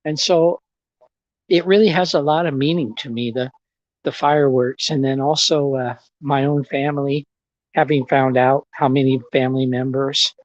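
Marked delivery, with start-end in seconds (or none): none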